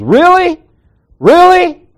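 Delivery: mock her disbelief; shrill retold disbelief; exasperated coworker.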